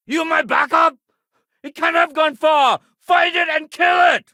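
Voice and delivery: loud, high-pitched yelled orders; urgent battle shouting. Acted. Officer sending backup after the creature, shouting. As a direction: shout over combat to your backup.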